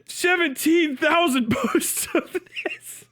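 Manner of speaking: ordinary talk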